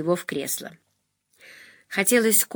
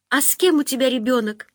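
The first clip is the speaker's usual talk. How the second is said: plaintive bleat; 6 semitones higher than usual. A narrator's reading. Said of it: "жалобно проблеяла Полина".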